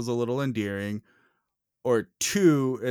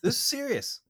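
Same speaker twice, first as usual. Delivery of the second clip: mock sincerity; 6 semitones higher, 5 dB quieter than usual. Mock-sincere defense of nephew.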